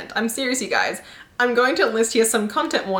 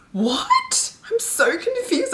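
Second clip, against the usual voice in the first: a much higher squeak of disbelief; shocked what; 13 semitones higher, much slower than usual. Real, not acted. Reacting to a review that compares erotica to Six of Crows, much higher in disbelief. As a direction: jump way up in pitch, stunned.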